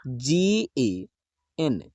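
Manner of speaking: very slow जी ए; slow spelling. Shown as a demonstration. Teacher spelling गण slowly for pupils. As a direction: dictate each letter slowly. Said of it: "गण का spelling हो जाएगा".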